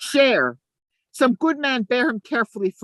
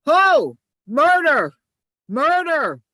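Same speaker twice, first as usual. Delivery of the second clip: loud, high alarm cry; calling out murder; 6 semitones higher, 5 dB louder, slower than usual. Acted. Iago raising the alarm in the dark street, loud cry. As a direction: raise the alarm at full voice.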